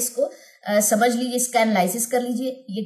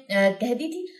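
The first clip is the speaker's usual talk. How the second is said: normal talking voice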